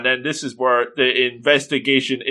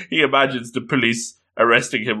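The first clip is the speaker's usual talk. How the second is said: ordinary delivery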